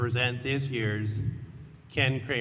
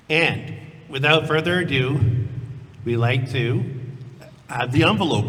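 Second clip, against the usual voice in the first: suspenseful awards presenter; 7 dB louder than usual. Acted. Awards reveal building suspense.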